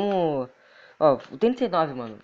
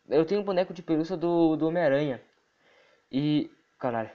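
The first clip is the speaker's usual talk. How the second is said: normal talking voice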